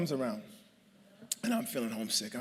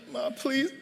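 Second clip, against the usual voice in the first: much higher, whiny half-laughing plea; mock tearful pleading; 14 semitones higher, 6 dB louder than usual. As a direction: beg like a crying kid, hammed up. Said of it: "I burst out in tears".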